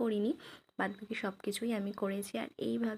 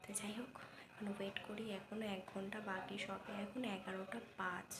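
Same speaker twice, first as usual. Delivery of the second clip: hushed talk; 8 dB quieter than usual. Real, not acted. Vlogger waiting for midnight, quiet hushed voice. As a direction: keep your voice down, someone's asleep next door. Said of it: "এত আস্তে আস্তে কথা বলছি".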